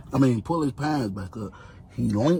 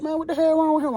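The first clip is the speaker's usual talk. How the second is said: pitched way up for the officer; mock high woman's voice; faster than usual. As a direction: play a casual female officer, high. Officer laughing asks.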